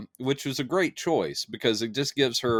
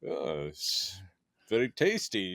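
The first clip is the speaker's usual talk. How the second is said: cartoon Russian; 4 semitones lower, 7 dB quieter than usual. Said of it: "cartoon Russian with bad grammar".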